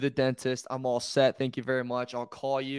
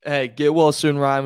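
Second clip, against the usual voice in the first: calling out; 10 dB louder than usual. Real, not acted. Calling out to absent host.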